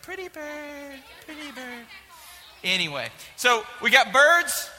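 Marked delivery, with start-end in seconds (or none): 0.0-1.9 soft, slow cooing pretty bird; blind kid voice. Retold or imitated. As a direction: croon it gently, slow. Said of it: "he's like".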